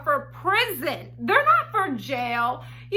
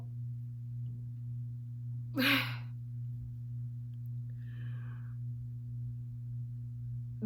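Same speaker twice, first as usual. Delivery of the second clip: stunned long sigh; 16 dB quieter than usual. Real, not acted. After hearing "killed six people.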